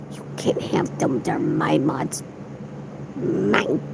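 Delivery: low, hushed, mock-possessive; guard them like a dragon hoarding gold; soft playful whisper, mine. Possessive joke about her mods.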